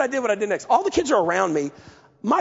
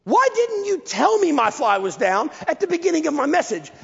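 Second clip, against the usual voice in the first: irritated demand; 6 semitones higher than usual. Retold or imitated. Preacher confronting the kid who let him preach with his fly down, exasperated. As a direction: snap at the kid in disbelief. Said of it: "And I said".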